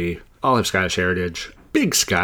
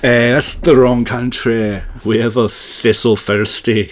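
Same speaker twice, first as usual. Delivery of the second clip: loud, slow mock-Scottish correction; hammed-up Scottish burr; Scottish accent; 5 dB louder, slower than usual. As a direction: lay the Scottish accent on thick, slowly. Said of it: "get the accents rolling".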